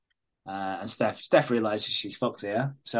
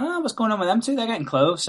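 high, prying gossip voice, lightly put on; mock nosy gossip; 9 semitones higher than usual. Mimicking a nosy woman asking Lynn about the couple. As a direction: imitate a nosy neighbour fishing for news. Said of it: "She's like".

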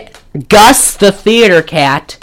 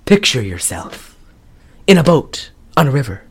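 low, hushed spoken-word recital; soft, portentous spoken-word delivery; 5 semitones lower, 5 dB quieter than usual. Acted. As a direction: recite song lyrics as halting, dramatic speech. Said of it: "have you heard the [name]".

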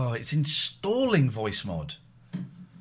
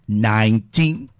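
slow drawn-out robot voice; 11 dB louder, much slower than usual. Shown as a demonstration. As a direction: stretch nineteen out slowly and loud. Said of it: "trying to do the voice yourself".

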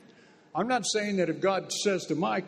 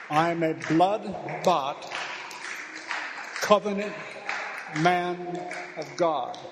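slow and louder, covenant ringing out; slow, louder declaration, covenant shouted; slower than usual. Shown as a demonstration. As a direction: repeat it stronger, almost shouting. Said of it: "You tell it".

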